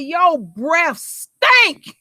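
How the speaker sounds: put-on loud, drawn-out kid announcement; slow, booming mimicry of a five-year-old; imitating loud child. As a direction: blurt it out loud, stretching each word. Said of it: "real loud".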